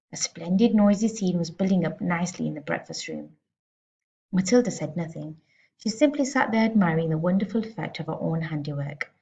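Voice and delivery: usual speaking voice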